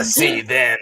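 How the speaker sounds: lightly rough smoker's voice; smoker's rasp. Acted. [name] on the phone with a smoker's voice. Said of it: "[name]'s been smoking for too many years".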